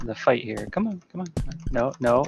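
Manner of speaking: normal talking voice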